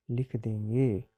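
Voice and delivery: normal talking voice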